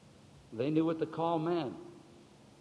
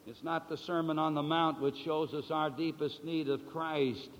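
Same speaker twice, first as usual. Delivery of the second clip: usual speaking voice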